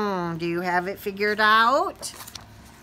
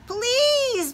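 begging. Acted. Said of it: "begged the spider".

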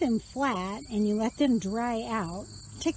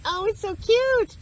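excited; 10 semitones higher, 6 dB louder than usual. Real, not acted. Spotting a bird in garden.

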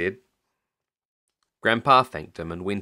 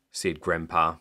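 usual speaking voice

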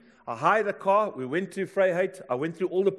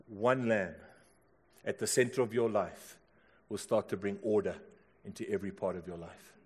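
hushed preaching litany; 5 semitones lower than usual. Real, not acted. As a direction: bring it down low, letting each phrase settle. Preacher's low, repeated litany.

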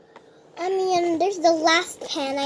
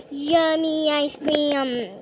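normal talking voice

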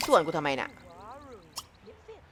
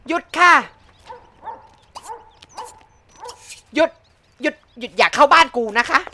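louder mock-polite order to stop; 4 semitones higher, 5 dB louder than usual. Real, not acted. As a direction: halt the intruder sharply.

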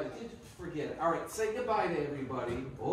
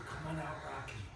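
whisper; 5 semitones lower, 9 dB quieter than usual. Shown as a demonstration. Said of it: "Everybody just whisper".

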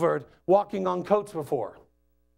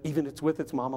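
ordinary talk

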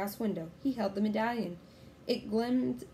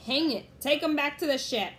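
loud, raised pirate bluster; shouted order; 7 semitones higher, 6 dB louder than usual. A narrator's reading. Captain Bones ordering his men, exasperated shout. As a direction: shout it to the crew. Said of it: "shouted Captain Bones".

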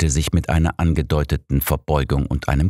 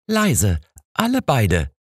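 firm; 9 semitones higher, slower than usual. A narrator's reading. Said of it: "sagte sie bestimmt".

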